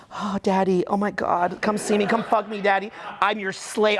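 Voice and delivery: a lightly voiced, pleading girlfriend; mock-needy girl begging, only slightly put on; imitating a lover. Imitating his girlfriend begging him on the phone, lightly voiced and pleading. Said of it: "and she's like".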